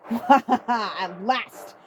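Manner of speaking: loud laughing triumph; gleeful villain laugh. Acted. Bowser sighting the comet, laughing in triumph. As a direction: laugh as if the prize is finally yours.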